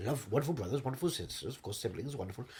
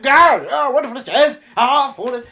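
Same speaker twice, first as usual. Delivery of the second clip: very loud, high-pitched hammed-up tumble; mock falling cries; 9 semitones higher, 15 dB louder than usual. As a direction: cry out as if tumbling downstairs. Acting a fall down stairs.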